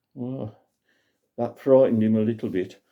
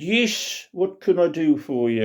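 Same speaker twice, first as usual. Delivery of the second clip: a raised, chirpy airman's voice, lightly done; RAF pilot voice; 7 semitones higher than usual. Acted. Old pilot greeting a customer at his shed, lightly voiced. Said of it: "dressed up all in R A F uniform".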